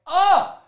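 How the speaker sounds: sharp, high, loud "ah"; frustrated cry. Real, not acted. Telling viewers about the gate, a sharp frustrated cry. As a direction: burst out in frustration. Frustrated outburst mid-story.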